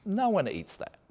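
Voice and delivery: high, disbelieving no one eats that, with No jumping up high; incredulous. Real, not acted. To viewers about a supposed Aussie food, high and incredulous.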